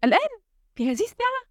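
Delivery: high-pitched incredulous questions; greater astonishment. A narrator's reading. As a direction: question it in sheer disbelief. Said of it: "في دهشة أكبر".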